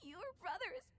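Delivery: high, halting tearful words; crying. Acted. Companion grieving over two brothers, tearful and halting. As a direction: struggle to speak through tears. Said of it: "You really are crying".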